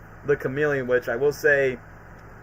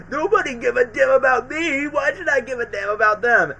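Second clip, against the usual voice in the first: much higher, strained angry cry; bitter outburst; 8 semitones higher than usual. Retold or imitated. Harry raging at Spider-Man mid-fight, bitter. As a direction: lash out, nobody cares. Harry yelling while being punched.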